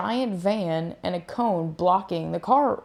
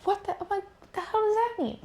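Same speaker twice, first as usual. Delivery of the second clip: confused; 12 semitones higher, 7 dB quieter than usual. Retold or imitated. Confused reaction to dad.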